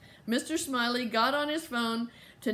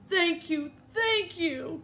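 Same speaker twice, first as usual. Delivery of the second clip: high, overjoyed thanks; grateful cry; 8 semitones higher, slower than usual. A narrator's reading. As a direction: cry out with gratitude. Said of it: "cried Mama".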